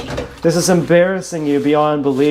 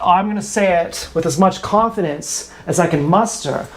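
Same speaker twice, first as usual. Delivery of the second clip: usual speaking voice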